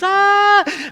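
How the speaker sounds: high mock scream, short and sharp; a quick high shriek of fright; imitated scream. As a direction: act out the wife's startled scream. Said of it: "So the first scream is".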